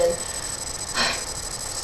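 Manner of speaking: soft breathy exasperated sigh; long sigh. Real, not acted. Fed up with the rude interviewer, a long sigh. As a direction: let it all out, fed up.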